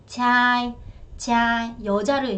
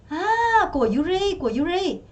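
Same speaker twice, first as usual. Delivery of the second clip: higher, answering của Yuri; mock exchange reply; acted answer; 6 semitones higher, much slower than usual. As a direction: say it like the answer in the skit. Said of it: "이렇게 얘기했어요".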